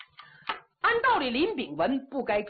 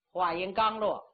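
usual speaking voice

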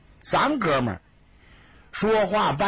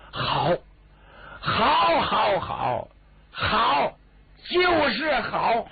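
overjoyed; 8 semitones higher, much slower than usual. Acted. 施大人听完经过，shouting for joy. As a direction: shout it like he could jump for joy. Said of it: "施大人乐得就差点没蹦起来".

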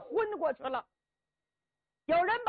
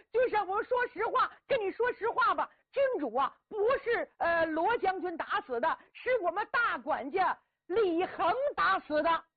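higher, hurried frightened pleading; pleading servant; 6 semitones higher, 3 dB louder than usual. Acted. Servant confesses to the strategist, frightened and pleading. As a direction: blurt the truth out in fear.

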